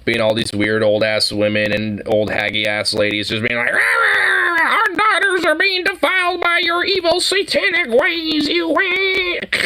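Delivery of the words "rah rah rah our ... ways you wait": much higher shrill-free hammed-up old lady shout; mock pearl clutcher. Friend mocks outraged old church ladies, in a hammed-up high shout. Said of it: "what they make the pearl clutchers look like".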